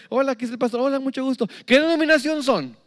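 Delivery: high, loud eager greeting then question; first-time visitor questioning the pastor; newcomer at church. Retold or imitated. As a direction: greet the pastor and demand the denomination. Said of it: "me dice".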